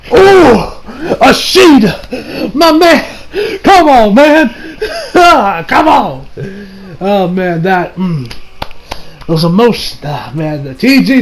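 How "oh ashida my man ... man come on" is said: loud, high, laughing protest to the author; overwhelmed fan outcry. Real, not acted. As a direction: cry out as if it's all too much. Fan overwhelmed by the emotional scene.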